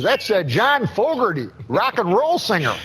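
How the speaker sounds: put-on high, matter-of-fact old ballplayer voice; Uecker impression. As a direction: explain it like a proud folksy announcer. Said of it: "I just love those Euchre impressions".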